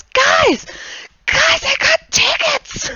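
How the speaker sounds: put-on very high, loud kid's yell; excited shout. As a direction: shriek with joy to his friends. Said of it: "and he goes".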